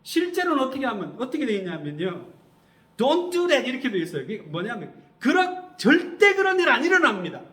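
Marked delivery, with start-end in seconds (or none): 3.0-3.7 loud, high-pitched scolding; stern rebuke. Acted. Peter corners Jesus to rebuke him, loud and scolding. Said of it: "거기 가서 윽박을 질러요". 5.2-7.4 high, loud, forceful refusal; acted scolding. Peter scolds Jesus like a teacher telling off a child, loud and high. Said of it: "그러면서 막 야단을 쳐요".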